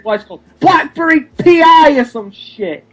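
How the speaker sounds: exasperated rant, voice climbing high; worked-up rant. Real, not acted. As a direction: get properly riled up and pitch it up. Ranting about the robot joke.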